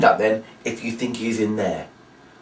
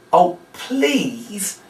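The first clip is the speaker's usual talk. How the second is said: mock pleading; 7 semitones higher than usual. Shown as a demonstration. Begging the kids.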